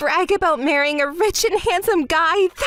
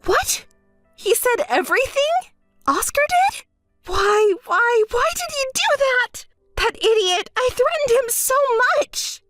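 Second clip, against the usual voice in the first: shocked; 8 semitones higher than usual. Acted. Shock at Oscar's confession.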